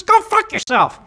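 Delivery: very high, loud parrot voice; swearing parrot. Acted. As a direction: curse like a parrot, pitched right up and loud. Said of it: "says to the parrot".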